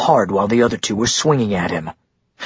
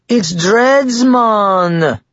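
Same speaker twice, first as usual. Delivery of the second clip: high, loud mock-Jamaican voice; jeering fake Jamaican, pitched way up; fake Jamaican accent; 11 semitones higher, 5 dB louder, much slower than usual. A narrator's reading. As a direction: put on a hammed-up Jamaican accent. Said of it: "another answered in a fake Jamaican accent".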